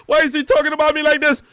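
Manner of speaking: high, loud mock outrage; exaggerated upset squawk, making fun of them; mocking whiny complaint. Mocking a rival's group chat complaint.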